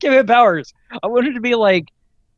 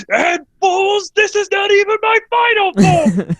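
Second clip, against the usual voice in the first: high, loud mock-villain boast; hammed-up villain; 5 dB louder, slower than usual. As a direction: declare it like an overblown cartoon villain. Hammy villain quote read from notes.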